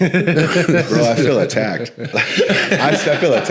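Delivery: high, loud, amused objection; mock-wounded through laughter; laughing protest. Real, not acted. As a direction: protest being called out, laughing loudly. Teased, protests while all laugh.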